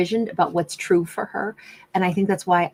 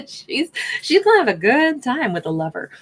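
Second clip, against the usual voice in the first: laughing; 6 semitones higher, 3 dB louder than usual. Real, not acted. Teasing about her love life.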